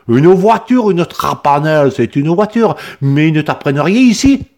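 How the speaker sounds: put-on accent du Midi, haut et fort, outré; il s'insurge contre l'école; s'insurgeant. A narrator's reading. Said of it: "s'insurgea-t-il". (accent méridional outré)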